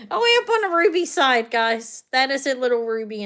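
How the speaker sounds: excited. Real, not acted. Excited reveal of ruby side.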